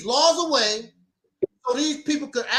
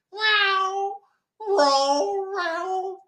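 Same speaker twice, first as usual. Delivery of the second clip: cat purr imitation; 8 semitones higher, much slower than usual. Imitating cat rubbing leg.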